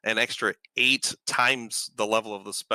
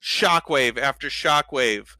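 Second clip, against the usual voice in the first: raised, slowed storytelling voice; game master narration; 5 semitones higher than usual. Acted. Game master describes the battle to players, in vivid narration. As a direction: paint the scene for the table.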